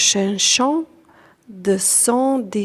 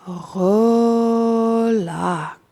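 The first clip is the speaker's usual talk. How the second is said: one word stretched over a slow exhale; long, drawn-out relax. Shown as a demonstration. Teacher guiding the out-breath, a long drawn-out meditative "Relax". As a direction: draw "Relax" right out, sustained and even.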